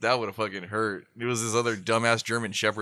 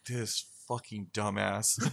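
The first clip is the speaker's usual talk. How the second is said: muttered insult; 2 semitones lower, 9 dB quieter, slower than usual. Retold or imitated. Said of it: "I'm like".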